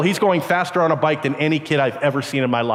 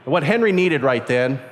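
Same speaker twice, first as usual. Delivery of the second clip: ordinary delivery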